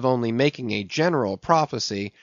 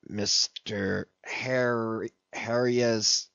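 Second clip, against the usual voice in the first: softer, slow puzzled reading-out; muttered; 6 dB quieter, slower than usual. A narrator's reading. Ahab puzzling over the letter's address, softly and slowly. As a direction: squint at the scrawl and mumble it slowly.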